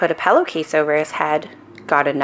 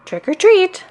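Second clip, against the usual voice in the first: high-pitched, more insistent call; a little louder; 13 semitones higher, 3 dB louder than usual. A narrator's reading. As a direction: pipe up so they hear. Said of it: "He said it a little louder".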